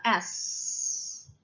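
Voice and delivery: S as a long, voiceless hiss; drawn-out S sound. Shown as a demonstration. Teacher sounding out the first letter of star, long hissed S. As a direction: hold the hissing sound of S. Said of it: "자, 그다음 S T A R".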